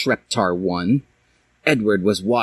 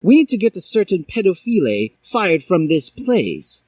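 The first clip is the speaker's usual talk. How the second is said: put-on higher, earnest boy voice; Harry; 9 semitones higher than usual. A narrator's reading. Harry asking the professor for help, higher earnest voice. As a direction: plead the case. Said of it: "said Harry".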